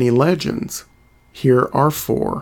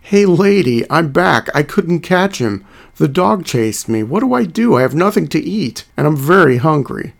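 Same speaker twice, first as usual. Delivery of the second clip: higher, louder desperate coyote; trickster tale voices; 3 semitones higher, 3 dB louder than usual. Acted. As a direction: play a frantic, hungry coyote calling out. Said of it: "and replied".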